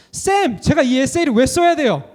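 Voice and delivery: student grumbling to the tutor, in a mock whine; whiny student complaint. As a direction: imitate a fed-up student pestering the tutor. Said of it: "막 이렇게 화를 내요".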